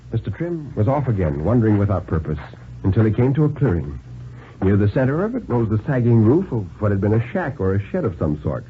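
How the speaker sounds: plain reading voice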